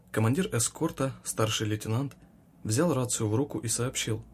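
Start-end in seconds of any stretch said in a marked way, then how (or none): none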